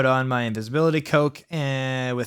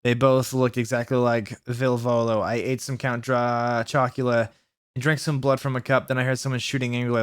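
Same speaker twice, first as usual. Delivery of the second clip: usual speaking voice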